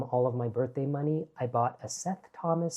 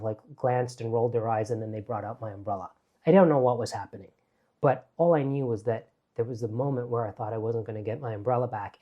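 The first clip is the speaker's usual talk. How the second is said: normal talking voice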